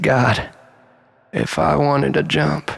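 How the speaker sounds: ordinary delivery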